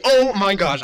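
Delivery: happy outburst at the screen; excited exclaim. Real, not acted. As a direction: burst out with delight. Card reveal, louder exclamation.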